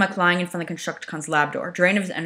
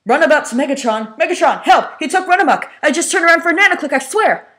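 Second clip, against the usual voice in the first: much higher, louder, fast frantic plea; panicked call for help; 11 semitones higher, 10 dB louder than usual. Acted. Runabout frantically calling Megatron over comm.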